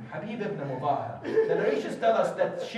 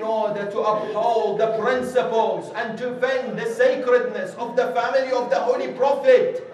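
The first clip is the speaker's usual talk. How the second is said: loud vow, climbing higher to a fast finish; loud oath building to a rush; fervent oath; 4 semitones higher, 8 dB louder than usual. Acted. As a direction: swear it with rising fire. Oath voiced by preacher.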